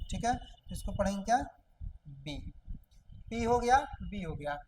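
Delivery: normal talking voice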